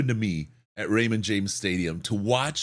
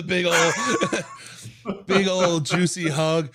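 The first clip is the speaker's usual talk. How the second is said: laughing; 8 semitones higher, 3 dB louder than usual. Real, not acted. Laughing while telling.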